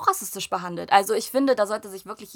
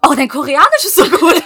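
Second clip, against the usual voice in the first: much higher and louder, breaking into laughter; laughing mock praise; 8 semitones higher, 10 dB louder than usual. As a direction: gush while cracking up. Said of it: "Ich so". (lachend nachgeäfftes Lob)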